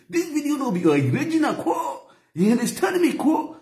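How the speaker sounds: high mock Hausa voice with a laugh; Hausa accent. Shown as a demonstration. Vlogger still faking a Hausa voice, high and playful. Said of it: "try the format to be Hausa language".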